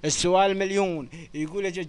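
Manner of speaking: ordinary talk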